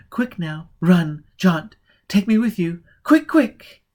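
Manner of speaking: panicked, begging him to take her; building from urgent to near scream; frantic pleading. Acted. Woman begs to be taken along.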